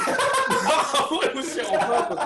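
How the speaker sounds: laughing hard, high and loud; can't get the words out for laughing; incredulous laugh through the line. Real, not acted.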